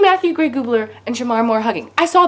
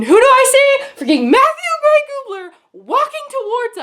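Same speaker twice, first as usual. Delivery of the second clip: starstruck excitement; 13 semitones higher, 5 dB louder than usual. Retold or imitated. Fan spotting the actor, starstruck. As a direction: build it up high, astonished by who appears. Reliving spotting her idol, freaking out.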